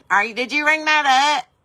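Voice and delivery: mock suspicious clerk; mock cashier. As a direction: imitate the nosy staff. Mimicking staring cashiers.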